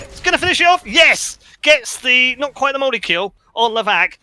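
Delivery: normal talking voice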